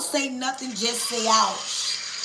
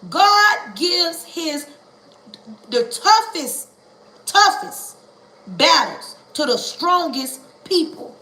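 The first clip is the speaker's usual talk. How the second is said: drawn-out words, toughest pushed higher and louder; slow emphatic build; 4 semitones higher, 4 dB louder, slower than usual. Acted. To the congregation, slow and emphatic on toughest. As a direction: lean hard on toughest, slowly. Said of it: "But I understand this right here".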